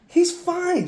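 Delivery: high-pitched imitated brush-off; protesting dad. Dad telling mother the boy needs no hospital, imitated high protest. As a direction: wave off the worry, voice jumping up.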